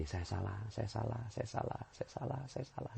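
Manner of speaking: repeated softly, fading away; a preacher showing how to say it seventy times, fading to a hush; fading humble repetition. Shown as a demonstration. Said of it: "Ngomongnya gini".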